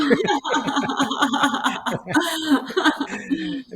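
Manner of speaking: long high burst of laughter; big laugh. Real, not acted. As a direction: crack up at the punchline. Laughing at own story.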